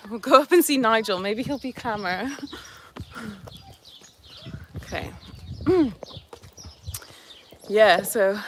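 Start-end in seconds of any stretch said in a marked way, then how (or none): none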